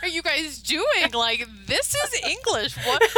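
high, loud, drawn-out disbelief with laughter in it; mock incredulous. Retelling a reply to people confused by the accent, mock incredulous. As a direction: stretch it out in laughing exasperation. Retold bafflement at accent remarks.

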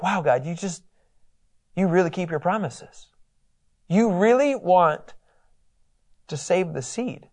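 soft, hushed wonder; a hushed, grateful aside to God; awed prayer. Retold or imitated. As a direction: marvel quietly at God. Joseph's awed prayer voiced.

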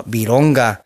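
ordinary talk

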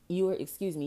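normal talking voice

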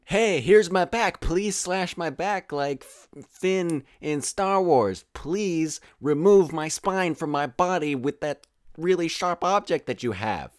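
high, loud, exaggerated pleading; sarcastic mock invitation. Foolish fighter offering their back to the enemy, hammed-up sarcastic plea. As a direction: beg for it with over-the-top sarcasm.

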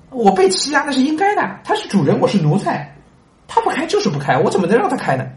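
high, frightened protest; the slave's panicked no; flustered refusal. Acted. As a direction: flinch back in alarm. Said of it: "奴才第一想法是".

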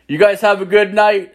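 high, sing-out goodbye; mock-polite farewell. Man bidding the five guys good night, mock-polite farewell. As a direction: lift the pitch into a put-on pleasant send-off. Retold sign-off while shutting the door.

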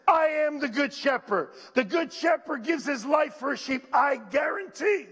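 high, driving pulpit delivery; fervent preaching. Real, not acted. Preacher convincing the church of what listeners understood, fervent preaching. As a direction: preach it with rising urgency.

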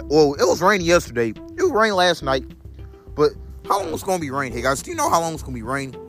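ordinary talk

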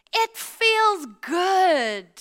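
FEELS stretched, high and loud; drawn out emphasis. Acted. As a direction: linger on "feels" very slowly. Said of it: "preacher's emphatic drawn-out line".

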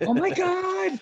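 high, squealed mock disbelief; mock amazement. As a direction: squeal it in exaggerated astonishment. Acting out reaction to applause.

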